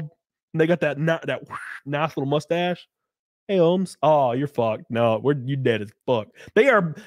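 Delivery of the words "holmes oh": normal talking voice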